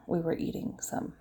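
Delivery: sheepish whisper; whispered confession. Real, not acted. Admitting to viewers she and Danielle snacked, whispered. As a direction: whisper it like a guilty secret.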